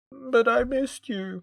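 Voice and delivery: high, slow, wounded little plea; hurt pout. Retold or imitated. Olivier telling Shara he missed her, slow hurt pout. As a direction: pout it out like a kicked puppy. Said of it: "he looks properly upset".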